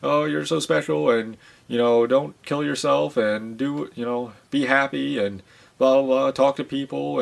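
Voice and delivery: high, sing-song imitation of cheery reassurance; dismissive parody of people offering encouragement; mock well-wishers. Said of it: "other people will just come on here and say".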